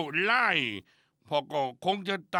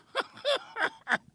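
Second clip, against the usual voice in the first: a big high burst of laughter; hearty laugh. Acted. Father laughs at spilled milk.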